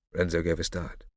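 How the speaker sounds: ordinary delivery, nothing marked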